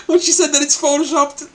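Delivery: high-pitched, laugh-choked telling; bursting with laughter. Real, not acted. Host recalls her photoshop remark, cracking up. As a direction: laugh right through the sentence.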